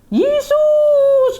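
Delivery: jeritan sangat tinggi dan keras; teriak Yesus. Retold or imitated. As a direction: jerit Yesus saat tabrakan. Teriakan saat menabrak sapi. (teriakan panik saat menabrak sapi)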